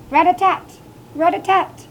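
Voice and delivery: loud, high, staccato tapping; pecking woodpecker. A narrator's reading. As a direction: rap it out like a woodpecker. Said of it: "The woodpecker pecked".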